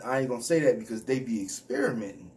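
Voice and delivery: normal talking voice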